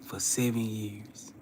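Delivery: softly whispered, slow; hushed reflection. Real, not acted. The speaker telling viewers how long the words replayed, whispered. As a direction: breathe it out almost silent.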